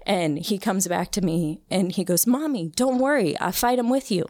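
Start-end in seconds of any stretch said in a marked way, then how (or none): 2.3-4.3 lightly imitating a small boy, much higher; a brave toddler promising to help; little son's voice.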